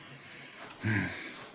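soft exhaled sigh. Acted. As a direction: breathe out a soft, slow sigh. Sigh before giving an order.

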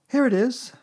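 put-on high, loud, excited boy; excited cry. A narrator's reading. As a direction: cry out on finding it. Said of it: "cried Christopher Robin excitedly".

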